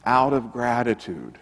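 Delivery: low, soft, heartfelt answer with pauses; quietly sincere. Retold or imitated. Former professor answers reporter why he became pastor, quietly sincere. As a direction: let it sink almost to a hush, humbly retold.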